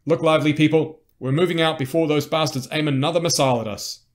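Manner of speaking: loud, high military rallying shout; urgent officer's call to move out; barked command. Acted. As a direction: shout orders to the whole squad. Commander orders troops to move out.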